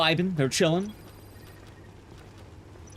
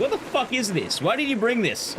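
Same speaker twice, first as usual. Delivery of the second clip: pitched-up mock outrage, what is this stuff; disgusted disbelief; 7 semitones higher than usual. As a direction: recoil at the taste, half joking. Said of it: "that was my reaction".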